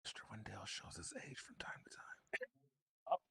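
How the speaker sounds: whispered aside. Real, not acted. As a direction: whisper it under your breath. Aside about Kevin's bad mic.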